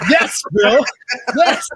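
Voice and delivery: laughing yell of yes, high and loud; a gleeful laughing yes; excited shout. Retold or imitated. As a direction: cheer it out with glee. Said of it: "And I'm just like".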